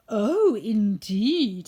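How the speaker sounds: slow, loud, drawn out "indeed"; mock courtly bow. A narrator's reading. Woodman bowing to the mouse queen, slow and grand. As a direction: play the gallant bowing. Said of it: "making a bow".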